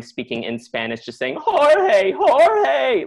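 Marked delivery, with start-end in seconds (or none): 1.4-3.0 loud, high wail with drawn-out vowels; angry tearful wail. Retold or imitated. Grandmother calling the boy in Spanish, angry wail. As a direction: call out like an angry crying grandmother. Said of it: "She was crying, ... already angry".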